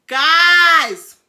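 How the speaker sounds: long, loud call to the audience; loud exclamation. Real, not acted. Storyteller to her viewers, loud exclamation. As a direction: shout it out and stretch it.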